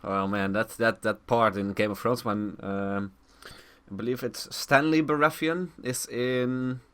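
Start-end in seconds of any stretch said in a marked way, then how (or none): none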